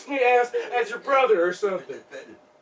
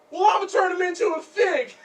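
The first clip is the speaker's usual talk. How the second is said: raised, high-pitched jealous threat; threatening shout; 7 semitones higher, 4 dB louder than usual. Acted. Threatens her date.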